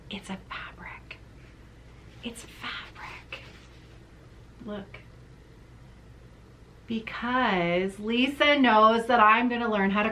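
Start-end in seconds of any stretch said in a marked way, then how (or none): 0.1-5.0 whisper. Real, not acted. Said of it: "revealing the bag's secret".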